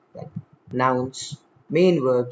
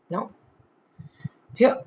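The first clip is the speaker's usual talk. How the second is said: ordinary talk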